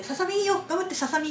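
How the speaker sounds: high, loud cheering for a pet bug; excited cheering. Retold or imitated. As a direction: cheer it on like a fan. Cheering her in-game bug. (興奮した声援)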